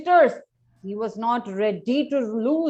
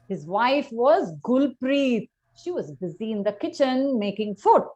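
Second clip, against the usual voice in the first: ordinary delivery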